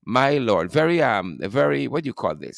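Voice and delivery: usual speaking voice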